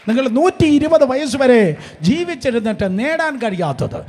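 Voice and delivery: loud, high preaching at full force; ringing pulpit proclamation; fiery preaching. Acted.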